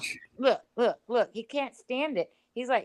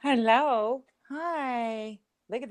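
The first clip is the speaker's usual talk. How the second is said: talking to a dog; 4 semitones higher, 4 dB louder than usual. Real, not acted. Cooing at the dog.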